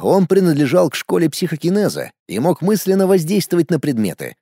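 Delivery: ordinary delivery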